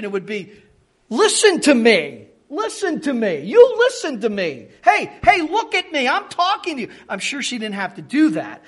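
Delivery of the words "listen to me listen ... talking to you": loud, higher, insistent calls; demanding attention. Retold or imitated. Mary to her son, insistently demanding he listen. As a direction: grab a distracted child's attention with raised voice. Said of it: "trying to get their attention".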